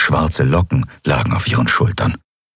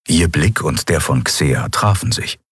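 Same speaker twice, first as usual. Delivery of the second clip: ordinary delivery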